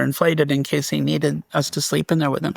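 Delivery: normal talking voice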